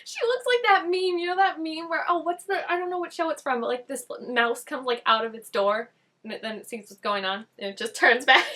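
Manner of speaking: real excited laughter in the voice. Real, not acted. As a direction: gush about the meme. Excited laughing retelling a meme.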